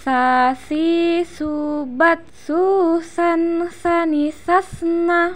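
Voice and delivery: drawn-out teaching chant; swung, held syllables. Shown as a demonstration. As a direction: stretch each syllable and hold the nasals. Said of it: "ditahan suaranya".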